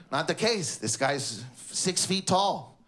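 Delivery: ordinary talk, nothing marked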